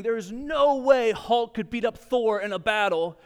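a hint of a big guy arguing, ham up the certainty; mock-serious nerd debate claim; emphatic friend. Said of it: "he was emphatically saying".

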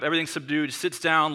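ordinary talk

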